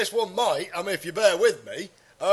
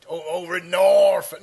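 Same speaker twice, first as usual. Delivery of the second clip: put-on Norfolk accent; 3 semitones lower, 6 dB louder than usual. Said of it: "they'll talk like that".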